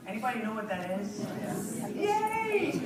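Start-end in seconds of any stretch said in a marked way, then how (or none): 2.0-2.8 happy the audience knows it; delighted yay. Real, not acted. Speaker to audience, high delighted yay.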